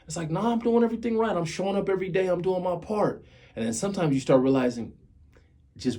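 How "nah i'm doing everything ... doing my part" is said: high-pitched, quick insistence; self-justifying protest. Retold or imitated. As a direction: protest quickly in a raised pitch, defending yourself. Acts out defending himself to himself.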